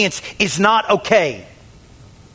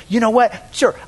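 ordinary delivery

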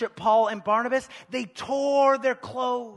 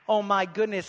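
ordinary talk

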